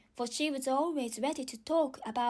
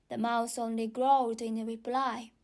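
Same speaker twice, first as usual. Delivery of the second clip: ordinary delivery, nothing marked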